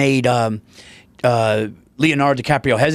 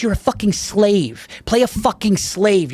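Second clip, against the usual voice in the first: slow, emphatic director voice pitched higher; Tarantino impression; 4 semitones higher, slower than usual. Director ordering his actor to play it harder, emphatic. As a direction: lay down the law to the actor, slowly. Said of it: "Jamie Foxx does an impression of Tarantino".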